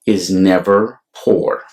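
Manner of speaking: ordinary delivery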